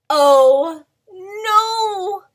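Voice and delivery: loud storybook alarm. Acted. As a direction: cry out the mishap. Dramatic storybook mishap.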